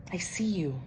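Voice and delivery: soft whispered reply. A narrator's reading. Kitty whispers tenderly to Unicorn. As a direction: breathe it gently.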